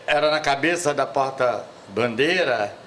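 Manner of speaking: usual speaking voice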